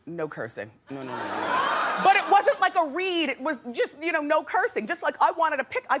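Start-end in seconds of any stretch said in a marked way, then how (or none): 0.1-1.7 imitating [name]. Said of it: "[name] looked at me and said".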